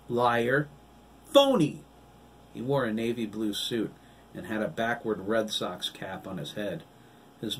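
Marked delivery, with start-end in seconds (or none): 0.1-1.8 rising loud jeers; taunting. A narrator's reading. Children jeer at the girl, high and loud. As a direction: spit the words at her.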